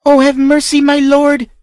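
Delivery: high, loud screaming plea; screamed plea. Acted. Whipped child screams to the count for mercy. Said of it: "screamed the child".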